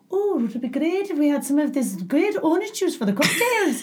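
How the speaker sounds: imitated inner monologue in a Scottish lilt; Scottish accent. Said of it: "your inner monologue has an accent".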